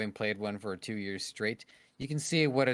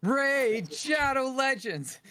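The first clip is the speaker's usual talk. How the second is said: slow, much higher, drawn-out sponsor-read voice; dramatic ad announcer; 4 dB louder, much slower than usual. Pretend. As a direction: hype the sponsor name like a cheesy YouTube ad. Mock sponsor plug punchline.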